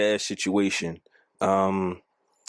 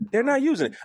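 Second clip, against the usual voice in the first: "using" repeated higher and insistent; emphatic repeat; 11 semitones higher, faster than usual. Real, not acted. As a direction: stress on "using", said higher the second time. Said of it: "Is it women not using it".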